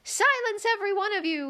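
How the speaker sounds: Tiger Lily crying out. Acted. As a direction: shout down the chattering daisies. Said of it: "cried the Tiger Lily".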